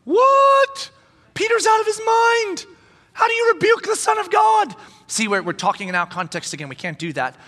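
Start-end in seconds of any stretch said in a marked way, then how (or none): 0.0-4.8 high, loud outraged voices; mock-outraged crowd, high and loud; shocked disciples. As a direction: play the whole room gasping in disbelief. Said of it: "Now we all go".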